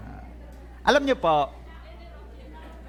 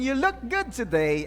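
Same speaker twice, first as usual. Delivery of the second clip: put-on American accent. Shown as a demonstration. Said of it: "ay American accent". (Amerikanong punto)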